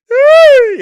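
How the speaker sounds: a sudden high burst of laughter; shrieking laugh. Real, not acted. Reacting to a replay, bursting into a high shrieking laugh.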